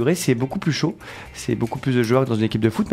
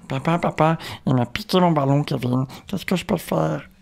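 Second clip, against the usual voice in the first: lightly voiced whiny little boy, higher; little Kevin's voice; 4 semitones higher than usual. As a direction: plead to daddy like a small kid. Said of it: "fais-nous la voix du petit Kevin". (petite voix d'enfant qui se plaint)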